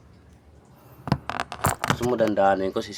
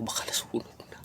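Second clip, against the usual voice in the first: whispered under the breath; whisper aside; 6 dB quieter than usual. Real, not acted. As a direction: whisper it to yourself. Aside about the fried food. (pabulong na sabi)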